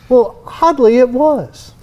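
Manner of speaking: mock-refined British "oddly"; posh British accent. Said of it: "in a British accent".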